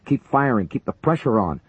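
high, loud order; yelling. A narrator's reading. Major yelling to keep up the fire, loud. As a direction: drive them on. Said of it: "Buchanan yelled".

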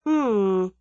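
a drawn-out doubtful hum. A narrator's reading. Young man unconvinced by his friend's argument, doubtful. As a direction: hum it, not quite convinced. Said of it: "said Tommy doubtfully".

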